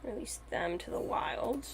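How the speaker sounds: ordinary talk, nothing marked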